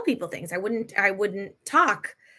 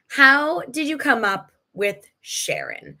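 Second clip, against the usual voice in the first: ordinary delivery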